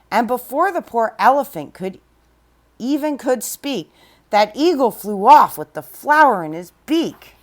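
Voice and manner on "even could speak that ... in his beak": usual speaking voice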